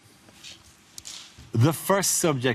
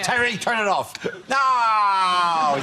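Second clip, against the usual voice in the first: shout; 7 semitones higher, much slower than usual. Retold or imitated. Voices crew yelling at Terry.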